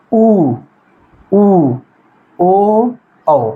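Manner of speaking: ऊ, ऊ, ओ, औ each held clearly as single vowels; vowel demo. Shown as a demonstration. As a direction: demonstrate ऊ, ओ and औ separately with a pause after each. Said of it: "इसका हम उच्चारण करके देख सकते हैं". (ऊ, ओ, औ को अलग-अलग खींचकर साफ़ बोलना)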